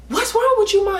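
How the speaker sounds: high, loud panicked shout at his mother; panicked cry. Retold or imitated. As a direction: yell it in shock, voice jumping up. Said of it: "He was freaking out".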